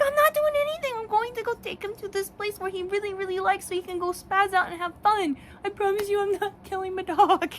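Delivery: high, loud self-defense; frantic mock protest. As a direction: protest your innocence in a high, frantic pitch. Acted protest to worried passersby.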